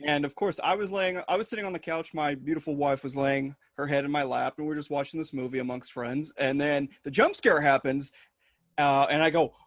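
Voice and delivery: usual speaking voice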